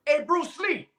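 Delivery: high taunting call, laughing through it; mocking taunt. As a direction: tease him about the jumpsuit.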